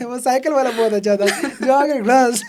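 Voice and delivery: laughing loudly while recalling the clip; words bubbling through real laughter; giggling about her fall on the bike. Real, not acted. Laughing at the cycle reel.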